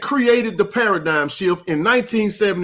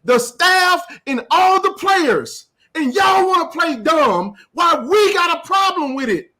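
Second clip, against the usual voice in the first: rising into a full, higher-pitched shout; heated shouting; 8 semitones higher than usual. Real, not acted. Outraged shout at the media rival over poached players. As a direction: build to an outraged yell at the rival show.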